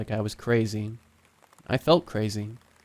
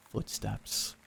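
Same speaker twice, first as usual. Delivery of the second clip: plain reading voice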